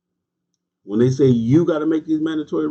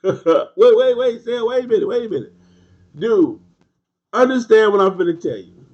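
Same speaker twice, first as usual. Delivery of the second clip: much higher and louder, laughing as he cuts in; laughing wait, pitch shooting up; excited laughing interruption; 10 semitones higher, 6 dB louder than usual. Real, not acted. Laughing, cutting off a commenter.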